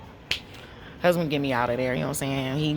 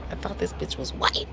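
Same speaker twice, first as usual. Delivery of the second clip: a whispered aside, much softer and lower; 11 semitones lower, 5 dB quieter than usual. Retold or imitated. The employer's private thought, whispered. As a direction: hiss it as a secret thought.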